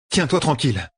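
high-pitched telling-off in a woman's voice; scolding sister. A narrator's reading. Sister scolds wounded Logain.

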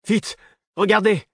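high-pitched, urgent shout; Devoir's urgent cry. A narrator's reading. Young man alerts his battered companion to the pit, urgent high cry. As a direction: cry out to make someone look, pitch leaping up. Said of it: "Le cri de Devoir".

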